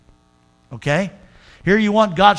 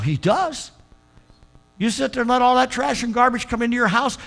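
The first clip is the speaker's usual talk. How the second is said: much higher, pressing warning; fervent exhortation; 5 semitones higher than usual. Acted. Pastor warning the church, higher and pleading. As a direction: plead against the filth.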